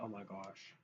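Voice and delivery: usual speaking voice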